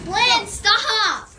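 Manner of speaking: sharp yell to stop; shouting. Real, not acted. Kids yelling at dog near bird.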